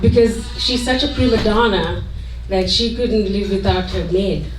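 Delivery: usual speaking voice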